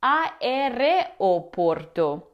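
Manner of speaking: aereoporto slowly as a-e-re-o-POR-to; common mispronunciation. Shown as a demonstration. Teacher showing what you might hear, aereoporto slowly with extra e. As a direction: separate every vowel in aereoporto. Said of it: "You might hear".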